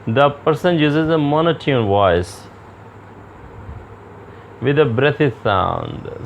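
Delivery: slow, deliberate dictation pace; slow slide reading. Acted. Lecturer reading slide text to students, slow and measured. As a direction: read each phrase out slowly for the class.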